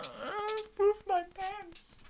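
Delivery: high, slow, crying whimper; mock whimper. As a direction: whine it like a panicked kid. Panicked pretend elevator man.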